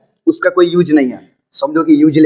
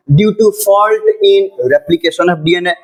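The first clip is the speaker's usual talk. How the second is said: slow dictation; much slower than usual. Acted. Teacher dictating notes to the class, slow and deliberate. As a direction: read out word by word, very slowly.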